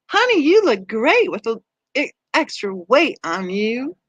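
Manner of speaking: sugary Southern drawl delivering a veiled insult. Shown as a demonstration. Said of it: "say it with your Southern drawl".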